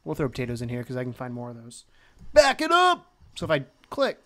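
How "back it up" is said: high playful holler; playful call-out. Pretend. Player dragging the raft, calling out high and playful. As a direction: sing out a command, pitched way up.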